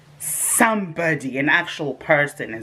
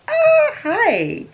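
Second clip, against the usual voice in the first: squeaky startled hi, much higher; flustered high hi; 4 dB louder than usual. Retold or imitated. Flustered squeak greeting the stranger on the train. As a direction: caught off guard by a handsome man.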